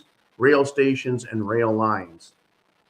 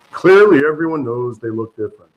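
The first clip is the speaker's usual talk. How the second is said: sarcastic; 10 dB louder than usual. Real, not acted. Pundit sarcastically corrects himself to viewers, loud. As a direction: bark clearly out in mock outrage, then hurry on.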